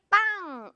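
"빵!" as one high, punchy burst; punched stress. Shown as a demonstration. Teacher illustrates stress on the first block, punched. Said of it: "이렇게 강세가 가는 경우들을".

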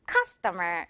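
CUSTOMER with a strong first syllable; stress on CUS. Shown as a demonstration. Teacher modelling the word for learners, "customer" stressed on CUS. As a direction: hit CUS, "-tomer" lighter. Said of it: "cus 부분이 가장 강해야".

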